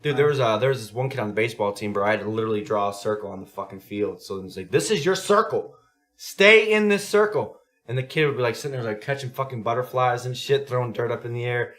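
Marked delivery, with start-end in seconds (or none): 4.7-7.6 loud, high, drawn-out coaching voice; exaggeratedly slow and emphatic; slow, firm instruction. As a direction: spell it out for a distracted little kid. Coach instructing a distracted kid.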